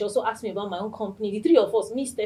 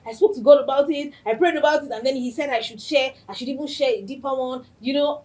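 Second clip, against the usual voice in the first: higher, louder earnest imitation; imitating Stephanie; 6 semitones higher, 7 dB louder than usual. Host mimicking her friend's earnest account of praying, higher and louder. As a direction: act her earnest testimony. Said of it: "She was like".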